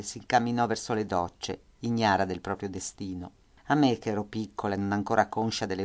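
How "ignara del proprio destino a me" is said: ordinary delivery, nothing marked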